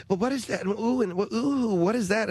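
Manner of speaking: put-on high fussy old-lady fretting; fussy grandmother. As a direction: fret over strange food like a grandmother. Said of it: "little grandmothers just".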